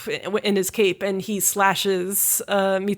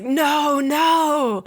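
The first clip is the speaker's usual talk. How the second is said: crying out; 7 semitones higher than usual. Retold or imitated. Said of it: "he's crying out and he's like".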